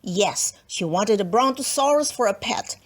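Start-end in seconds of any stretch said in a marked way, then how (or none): none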